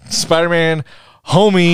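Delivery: calling out Spider-Man, homie loud and high; mock title shout. Friend hyping a joke title to the group, loud. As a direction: announce it like a movie title. Joking new movie title.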